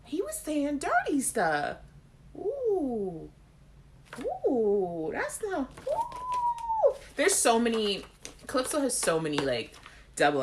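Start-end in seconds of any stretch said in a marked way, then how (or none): 0.0-7.0 high, drawn-out scandalised oohs; mock-shocked, stretching it out high; scandalised reaction. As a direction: act out a scandalised gasp of gossip. Mimicking a reaction to dirty lyrics.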